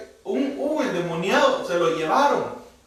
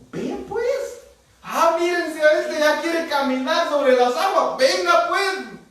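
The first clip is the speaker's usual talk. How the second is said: coaxing Jesus; 11 semitones higher, slower than usual. Pretend. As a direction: call him over like a child learning to walk. Jesus coaxing Pedro like a child. (Jesús llamando a Pedro como a un niño, voz muy aguda)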